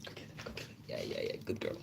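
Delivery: a soft whisper off to the side, hushed; whispered aside. Real, not acted. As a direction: whisper so the stream barely hears. Whispering aside to friend off-mic.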